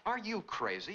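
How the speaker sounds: high, disbelieving outburst; you can't be serious; incredulous. Acted. Friend hears poisoning claim, high incredulous outburst.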